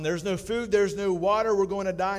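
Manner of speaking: first castaway keeps wailing about death; panicked. Acted. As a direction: keep panicking.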